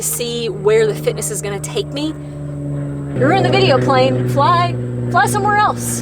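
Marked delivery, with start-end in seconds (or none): 2.1-6.0 high, slow, exasperated scolding; mock scolding a plane. Real, not acted. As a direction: shoo the plane away, half-joking.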